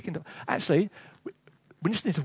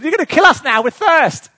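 loud, high, whingeing accusation; whining complaint; 9 semitones higher, 11 dB louder than usual. Acted. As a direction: moan it like a thirsty complainer. Whining and moaning about thirst.

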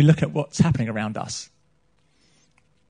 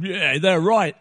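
put-on gruff accent; much slower than usual. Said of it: "in a kind of gruff accent".

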